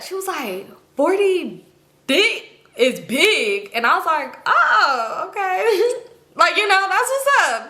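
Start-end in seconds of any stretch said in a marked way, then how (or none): 1.0-3.7 retold gossip, drawn out word by word; stunned confession. Friend confiding in her in the bathroom, drawn-out stunned confession. 4.5-7.7 high-pitched, giggly reaction, retold; amused surprise. Reacting to a friend's intimate confession, amused high surprise.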